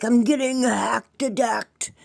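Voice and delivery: over-the-top fake alarm, high and drawn out; mock panic. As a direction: act out freaking out over being hacked. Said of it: "I'd go off and be like".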